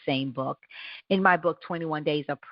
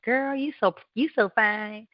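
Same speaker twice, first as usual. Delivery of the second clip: hyping yourself up; 7 semitones higher than usual. Shown as a demonstration. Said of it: "be like".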